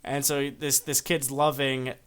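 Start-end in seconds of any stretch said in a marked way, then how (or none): none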